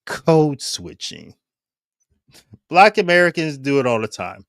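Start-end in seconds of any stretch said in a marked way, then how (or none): 0.1-1.3 slow, drawn-out "code switching"; dramatic reveal. Shown as a demonstration. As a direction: land the punchline slowly. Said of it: "And that, my friends".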